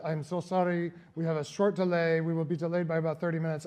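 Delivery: usual speaking voice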